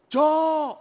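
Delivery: high, loud call for the bird. Acted. Husband calling his silent bird, loud call. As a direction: shout the bird's name, worried now.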